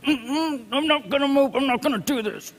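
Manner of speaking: a hint of a stiff-necked believer refusing to move; stubborn refusal. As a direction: dig your heels in like a stubborn child. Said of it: "the Bible calls us a stiff-necked people".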